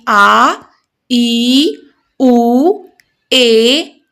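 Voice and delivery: आ, ई, उ, ए held long and slow; long vowels. Shown as a demonstration. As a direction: stretch each long vowel to show its length. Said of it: "दो मात्रा का समय लगता है". (दीर्घ स्वरों को लंबा खींचकर बोलें)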